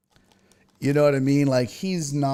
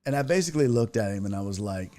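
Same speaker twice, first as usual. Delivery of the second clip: normal talking voice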